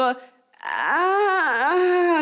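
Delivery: drawn-out wary aah; a long knowing hmm-like aah; suspicious ah. Retold or imitated. Voicing a suspicious reaction. (lang gezogenes misstrauisches Aah)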